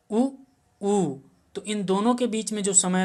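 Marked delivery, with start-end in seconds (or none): 0.1-1.1 first उ short, second उ held longer. Shown as a demonstration. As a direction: contrast short उ with a long उ. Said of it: "इसको कैसे हम उच्चारित करेंगे". (ह्रस्व और दीर्घ उ का अंतर)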